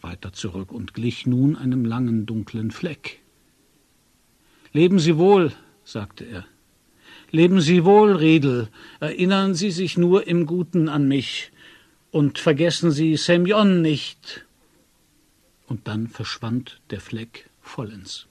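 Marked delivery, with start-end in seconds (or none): 4.7-5.5 loud farewell called into the fog. A narrator's reading. Departing man calls farewell to Riedel, loudly. 7.3-14.4 calling farewell. A narrator's reading. Farewell called from afar.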